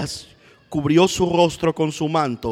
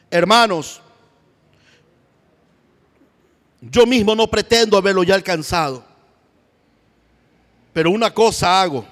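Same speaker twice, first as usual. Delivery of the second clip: loud, higher reading; fervent proclaim; 4 semitones higher, 5 dB louder than usual. Acted. Preacher voicing Paul to church, loud and fervent. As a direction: call out to the brothers.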